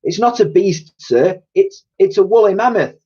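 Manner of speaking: put-on high, bright little girl protesting; pleading small girl voice. Little girl answering old man.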